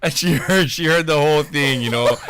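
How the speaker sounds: ordinary talk, nothing marked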